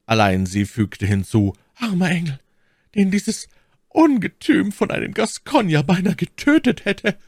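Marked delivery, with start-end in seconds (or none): 1.8-7.2 much higher, pitying lady's voice. Acted. My Lady pities the count's wounds, high voice. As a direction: pity the wounded angel, then spit out the Gascon.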